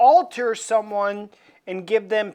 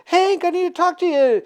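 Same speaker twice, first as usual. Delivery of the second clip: high pleading reporter; 9 semitones higher than usual. Retold or imitated. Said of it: "is like".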